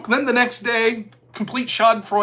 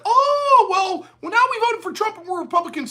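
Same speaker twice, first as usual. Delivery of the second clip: much higher simpering mock voice; mocking tech people; 8 semitones higher than usual. Mocking tech people who suddenly back Trump, high simpering mock. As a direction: play a smug tech bro who just switched sides. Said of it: "they're like".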